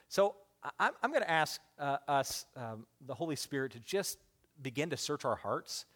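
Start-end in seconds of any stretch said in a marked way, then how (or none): none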